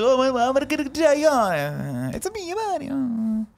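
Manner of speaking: cartoon Italian accent, very high-pitched; hammed-up Italian accent, drawn out high; Mario. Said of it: "The stupid stereotypical Italian accent".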